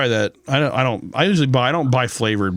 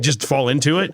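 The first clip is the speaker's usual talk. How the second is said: normal talking voice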